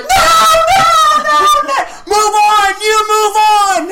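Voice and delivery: very high, loud frantic shouting; panicked mom. Retold or imitated. Mom shouting down the comic, frantic. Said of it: "the mom's like".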